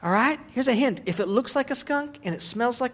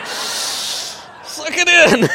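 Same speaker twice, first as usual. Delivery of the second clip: laughing aside; 7 semitones higher, 8 dB louder, much slower than usual. Acted. Joking about aging bodies.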